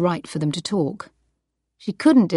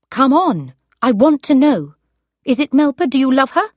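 high and loud, demanding; demanding shout; 8 semitones higher, 8 dB louder than usual. Acted. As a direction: force him to answer. Wife demands answer from husband.

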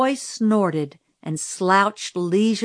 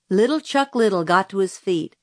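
ordinary delivery

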